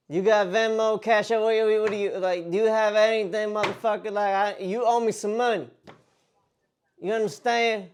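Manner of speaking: mock-angry demand. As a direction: lean on them for payment in a country bumpkin accent. Prank caller pretends to demand money.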